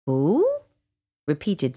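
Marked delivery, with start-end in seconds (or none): 0.1-0.5 owl hoot swooping upward. A narrator's reading. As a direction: hoot it as a rising question. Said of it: "an owl hooted".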